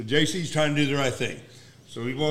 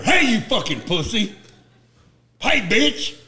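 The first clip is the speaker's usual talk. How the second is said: high, loud taunting call across a bar; J C's taunting voice; 8 semitones higher, 4 dB louder than usual. Retold or imitated. Friend overhears J C insulting a man, high taunting holler. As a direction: holler it high like a drunken heckler. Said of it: "I hear J C's voice".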